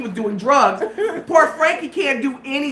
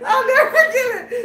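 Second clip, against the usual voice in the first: high, breathless with laughter; real cracking up; 13 semitones higher, 3 dB louder than usual. Real, not acted. Storyteller overcome by the memory, cracking up. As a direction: gasp the words out between laughs.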